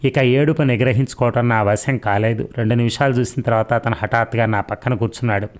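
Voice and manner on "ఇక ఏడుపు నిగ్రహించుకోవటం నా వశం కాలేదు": ordinary delivery, nothing marked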